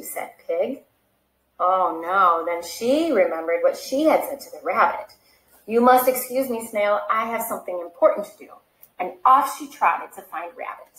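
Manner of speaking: lively read-aloud storytelling voice; animated picture-book reading; story narration. Acted. Reads picture book to children.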